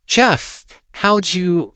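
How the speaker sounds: high, breathless disbelief; stunned. Acted. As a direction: gasp it out in shock. Brother stunned after fight.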